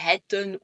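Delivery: "hetten" with a short open "è"; wrong short E. Shown as a demonstration. Teacher showing what not to say, wrong short E. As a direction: say "hetten" with a brief "è" in the first syllable. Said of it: "tu ne vas pas dire".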